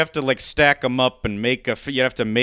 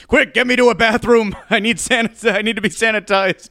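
high, loud panicked yelp; panicked shout; 10 semitones higher, 6 dB louder than usual. Retold or imitated. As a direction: shriek it in mock panic. Panicked parent voiced, story.

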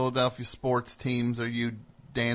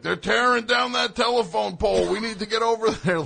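high, loud, urgent radio call; panicked mock report over the scanner; frantic police scanner; 10 semitones higher, 5 dB louder than usual. Host retelling Philly riot scanner calls, frantic mock urgency. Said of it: "the police scanner that night".

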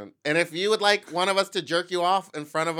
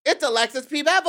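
high, big guest announcement; announcer name reveal; 9 semitones higher, 5 dB louder than usual. Pretend. Host revealing the guest, high announcer shout.

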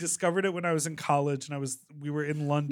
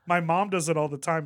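usual speaking voice

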